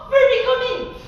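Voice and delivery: high, loud shout; martial stomp call. Shown as a demonstration. Teacher demonstrating the kick on the cloud, shouted. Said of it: "Quand je dis go, tu dis Fumikomi".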